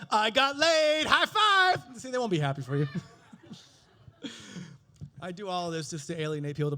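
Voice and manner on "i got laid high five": high, loud hammed-up triumph; jubilant boast. Man honestly bragging about last night, mock jubilation. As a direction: crow it and build to a shout.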